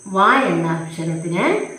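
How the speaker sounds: usual speaking voice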